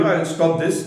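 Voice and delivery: ordinary talk, nothing marked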